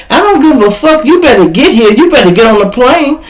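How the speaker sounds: angry lawyer. Retold or imitated. Lawyer yells at him to come.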